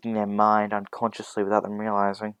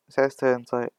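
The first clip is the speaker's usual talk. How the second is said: ordinary delivery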